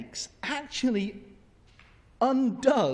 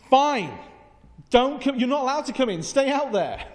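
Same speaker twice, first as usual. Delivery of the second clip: loud, higher huffy outburst; mock cross parent; 5 semitones higher, 6 dB louder than usual. Parent gives up calling kids in, in a mock huff. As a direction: put on a loud parental strop.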